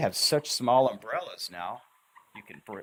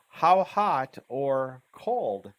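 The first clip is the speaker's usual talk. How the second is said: slow and clear; 4 semitones higher, 6 dB louder, much slower than usual. Shown as a demonstration. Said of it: "This slash can mean and or or".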